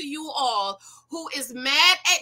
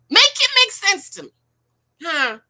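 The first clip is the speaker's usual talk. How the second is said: exasperated; 8 semitones higher, 6 dB louder than usual. Real, not acted. Exasperated rant at women.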